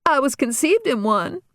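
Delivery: put-on sick-and-tired teenage rant. Daughter complaining to her family, mildly exasperated. Regina voiced higher.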